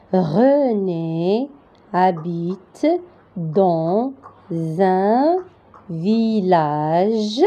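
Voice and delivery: very slow dictation with stretched syllables and pauses; slow repetition. Shown as a demonstration. Teacher repeating the first sentence for learners, slowly. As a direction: read it phrase by phrase, holding the vowels. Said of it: "je répète la phrase".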